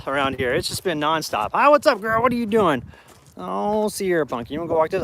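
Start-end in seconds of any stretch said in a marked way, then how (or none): 1.5-5.0 pet talk. Real, not acted. High voice greeting dog.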